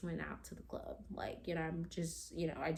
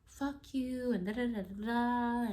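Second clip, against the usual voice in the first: high-pitched mock cussing; angry cussing; 6 semitones higher than usual. Boyfriend cussing out his drunk girlfriend, angrily. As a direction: imitate an angry cussing rant. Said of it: "He was cussing".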